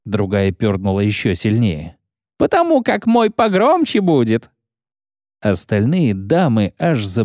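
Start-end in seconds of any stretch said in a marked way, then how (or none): 2.4-4.5 rowdy guardswoman. Acted. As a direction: jeer at him like a rude soldier. Guardswoman boasts.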